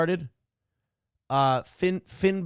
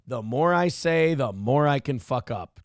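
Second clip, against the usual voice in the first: normal talking voice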